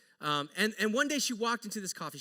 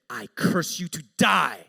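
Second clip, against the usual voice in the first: slow, louder, ominous pronouncement; menacing curse; 5 semitones lower, 7 dB louder, slower than usual. Retold or imitated. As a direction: intone it like a dark spell. Barista's curse retold.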